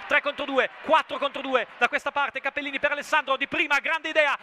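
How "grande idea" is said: ordinary talk, nothing marked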